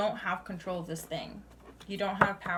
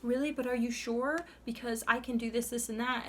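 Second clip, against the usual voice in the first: higher-pitched imitation of someone bargaining; mock insistent questioning, pitched up; pleading doubt; 6 semitones higher than usual. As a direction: mimic a person refusing to accept it. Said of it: "and you're like".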